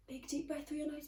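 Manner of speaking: breathy whispered instruction, fast. Shown as a demonstration. Teacher whispering a breathing exercise to children. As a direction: whisper the breathing cue to the class. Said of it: "a bit quieter".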